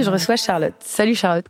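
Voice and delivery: ordinary delivery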